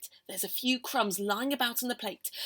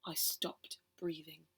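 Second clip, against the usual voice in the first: hushed; 6 semitones lower, 10 dB quieter, slower than usual. A narrator's reading. Fear of being caught.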